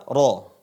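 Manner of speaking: RO with a thick r, loud; ra tebal. Shown as a demonstration. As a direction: say "Ro" heavy and rounded toward O. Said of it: "Tapi dibaca apa?". (ucapkan "Ro" tebal dan lantang)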